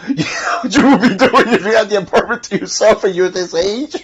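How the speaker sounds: high, loud teasing through laughter; laughing through the words, high-pitched. Real, not acted. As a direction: crack up while teasing a friend. Cracking up at own teasing.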